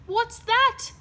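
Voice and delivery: high, loud startled question. A narrator's reading. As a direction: jump at the clatter. Startled by falling tooth.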